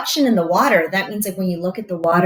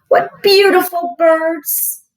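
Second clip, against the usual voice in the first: awed duckling; 8 semitones higher, 5 dB louder, slower than usual. Acted. Duckling sees swans.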